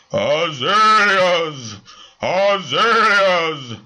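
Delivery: loud, slow booming spectral cry; booming ghost. Acted. Spirit answering the medium, slow booming cry. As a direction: boom the word slowly from beyond. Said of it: "boomed the spirit".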